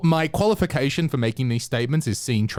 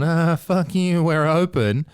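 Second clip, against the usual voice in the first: higher, drawn-out taunt; mock gloating; 5 semitones higher, 3 dB louder, slower than usual. Bragging to friends in lockdown, gloating. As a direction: rub it in, slow and high.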